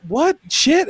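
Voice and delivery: high, loud burst of disbelief; mock bewilderment. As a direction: act out your mind being blown. Retells being baffled by Big Bang.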